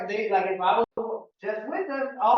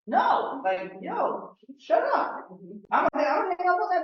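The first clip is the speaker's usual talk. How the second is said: fed-up brush-off; 9 semitones higher, 3 dB louder than usual. Acted. Acting a rude fed-up seller.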